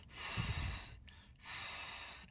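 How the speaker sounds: સ as a long, soft, voiceless 'sss'; cooker-steam hiss. Shown as a demonstration. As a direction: let સ leak out like steam from a pressure cooker. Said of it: "હવા નીકળતી હોય કુકરમાંથી એમ બોલવાનું છે". (કુકરની હવા જેવો લાંબો, હળવો 'સ્સ્સ')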